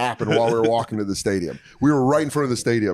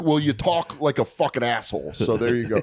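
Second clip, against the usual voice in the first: ordinary talk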